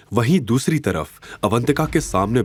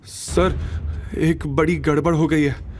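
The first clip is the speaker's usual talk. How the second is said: higher, anxious, hurried voice; nervous report; 9 semitones higher than usual. Acted. Assistant tells his boss the bad news, anxiously. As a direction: break the bad news with dread. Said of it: "Dinesh ने घबराते हुए कहा".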